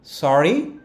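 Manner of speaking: "সরি" rising as a question; rising one-word question. Shown as a demonstration. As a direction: ask "সরি" with a lift. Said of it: "বলেন এভাবে".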